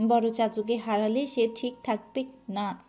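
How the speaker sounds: usual speaking voice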